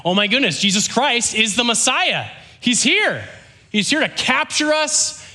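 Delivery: high-pitched, excited crowd voice; acted amazement. Israelites realize the Messiah has come, acted amazement. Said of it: "they're blowing their minds".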